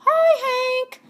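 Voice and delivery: tumbleweed calling to Hank, cheerfully; cheery tumbleweed calling out. Acted. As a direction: sing out a bright hello. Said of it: "Rosie the tumbleweed called out".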